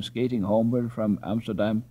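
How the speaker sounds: plain reading voice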